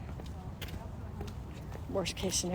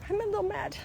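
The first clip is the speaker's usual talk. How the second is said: much higher small sulky voice; mock little child; 11 semitones higher, 4 dB quieter, faster than usual. Little kid admitting to the parent, high and small.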